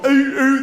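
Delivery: short, high, loud seal calls; cute seal bark. Retold or imitated. Showing what seals don't say, short high barks. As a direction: imitate a storybook seal barking. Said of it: "seals don't go".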